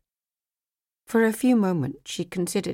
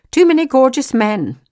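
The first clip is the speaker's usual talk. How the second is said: higher, louder teasing line; teasing jab; 8 semitones higher, 11 dB louder than usual. Acted. Agent teasing Megan about men, louder. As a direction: needle her with a knowing jab.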